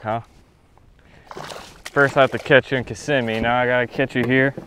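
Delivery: ordinary talk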